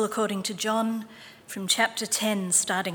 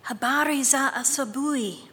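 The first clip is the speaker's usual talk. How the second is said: ordinary delivery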